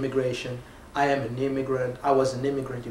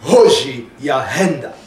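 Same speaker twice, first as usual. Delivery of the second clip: Hoji Ahenda boomed out slow and loud; big, drawn-out thunderclap delivery; thunder-like name; 11 dB louder, slower than usual. Shown as a demonstration. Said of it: "as if you would be replicating a thunder".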